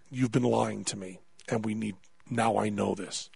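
low, soft accusation; deep drop in pitch, stern and hushed; grave doctor. Retold or imitated. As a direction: imitate a doctor quietly confronting a patient. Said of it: "his tone just changes entirely".

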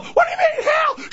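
put-on shrieked, very high kid voice; screaming kid. Kid challenges the parent's threat, screaming. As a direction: squeal back in protest. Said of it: "their eight screaming kids".

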